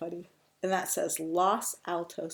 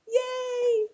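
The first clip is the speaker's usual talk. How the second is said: high, loud, drawn-out cheer; 7 dB louder than usual. A narrator's reading. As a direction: celebrate for the young listeners. Truck finally freed.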